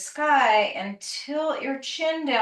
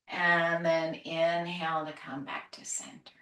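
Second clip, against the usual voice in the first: soft relaxation cues; 4 semitones lower, 4 dB quieter than usual. Acted. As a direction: ease them into letting go. Soothing relaxation cues near session end.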